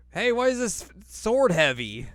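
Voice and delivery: hammed-up high Inuyasha, slow and loud. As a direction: whine it like a frustrated hero. Inuyasha voiced, much higher.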